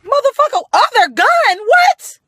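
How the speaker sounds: high, loud disbelieving yell; squeaky outraged holler; shocked shout. Real, not acted. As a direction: blurt it out in shock. Shocked outburst opening the story.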